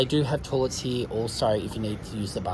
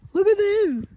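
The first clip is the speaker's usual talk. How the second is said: pet voice; 4 dB louder than usual. Real, not acted. Cooing at the ponies.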